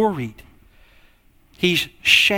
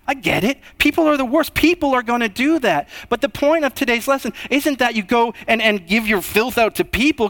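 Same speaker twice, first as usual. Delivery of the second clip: normal talking voice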